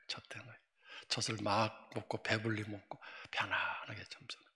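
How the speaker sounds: put-on soft, hushed whisper of a sleeping baby. 평안한 아기를 묘사. (잠든 아기를 떠올리며 속삭이듯)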